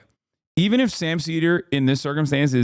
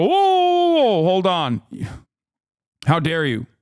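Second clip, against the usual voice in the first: mock outraged Republican; 6 semitones higher than usual. Mocking Republicans acting shocked at criticism. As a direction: ham up their fake indignation. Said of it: "they just turn around and go".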